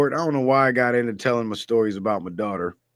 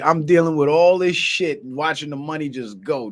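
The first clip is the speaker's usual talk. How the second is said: ordinary talk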